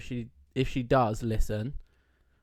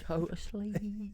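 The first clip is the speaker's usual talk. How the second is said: put-on Cockney accent; 9 semitones higher, 8 dB quieter, much slower than usual. Copying the high Cockney voice.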